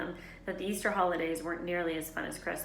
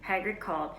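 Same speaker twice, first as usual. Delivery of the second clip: ordinary delivery, nothing marked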